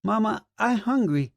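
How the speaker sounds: put-on high, small child's voice; a hungry toddler tugging at mom; little boy. A narrator's reading. Small son asking his mother for food, high child voice.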